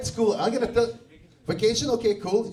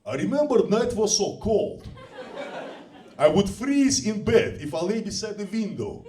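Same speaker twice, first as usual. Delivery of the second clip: Russian accent. Shown as a demonstration. [name] lyrics read as Russian lyrics. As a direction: recite the ballad with a mild Russian accent. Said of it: "the most Russian sounding song".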